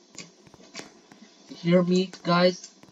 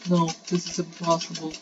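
ordinary talk, nothing marked

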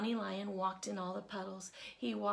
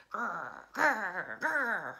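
growled; 8 dB louder, much slower than usual. A narrator's reading. Johnny Lion in his cave, growling louder. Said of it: "He growled".

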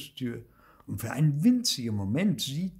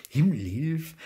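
higher pitch, lightly voiced old saying; great-grandma's exclamation; 5 semitones higher, 4 dB louder than usual. A narrator's reading. Recalling great-grandma's saying, mildly exclaimed. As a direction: exclaim it as Uroma used to. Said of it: "das hat Uroma immer gesagt".

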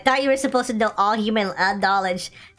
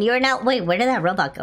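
ordinary talk

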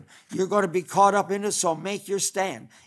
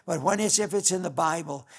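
ordinary delivery

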